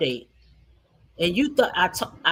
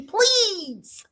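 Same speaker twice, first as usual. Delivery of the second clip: high, drawn-out mocking plea; mock pleading; 10 semitones higher than usual. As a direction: beg for pity in an exaggerated whine. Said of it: "talking real low and sad".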